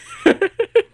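high, loud laughing fit; uncontrolled laughter. Real, not acted. Player cracking up after saying sorry, high laughter. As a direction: break up laughing.

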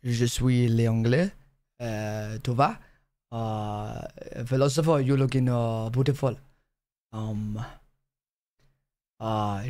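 low, halting French accent; French philosopher accent. Shown as a demonstration. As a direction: ponder aloud as a French philosopher. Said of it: "philosopher, French".

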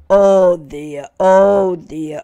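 very loud wailing wolf; wolf howling as his belly rumbles; howled. Acted. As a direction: howl it in belly pain. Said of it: "the wolf howled".

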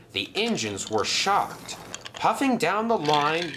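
ordinary delivery

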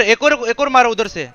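usual speaking voice

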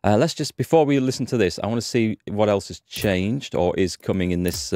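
normal talking voice

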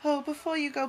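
normal talking voice

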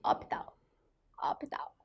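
HOSPITAL softly said as "ópital", no S; S left out. Shown as a demonstration. Teacher shows how ugly it would be, S left out. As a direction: softly drop the S from HOSPITAL, twice. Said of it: "Ficaria feio".

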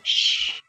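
long unvoiced shush. Shaurya silencing Chandrika before her confession, shushing. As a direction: gently hush someone mid-sentence. Finger on her lips to hush her.

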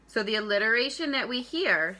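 ordinary delivery

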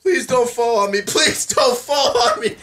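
high-pitched begging; plead, stretching it out the second time. Real, not acted. Begging as things topple.

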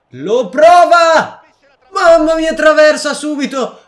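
breathless italian goal commentary. Acted. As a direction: call it like a TV commentator. Commentating a shot. (telecronaca concitata sulla traversa)

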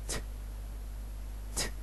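ت said as a short crisp t; ت just a short t; the t sound. Shown as a demonstration. Teacher showing the t ending to learners. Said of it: "هننطق الدي بعدهم كأنها".

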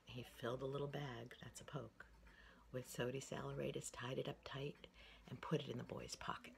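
soft whispered storytelling; whispered story. Acted. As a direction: breathe the story out in a hush.

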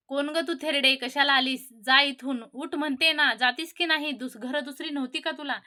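usual speaking voice

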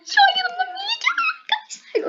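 very high laughing, teary squeal; excited squeal. Real, not acted. Vlogger announcing Melike is beside her, high laughing squeal. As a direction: gush with joy that she's here.

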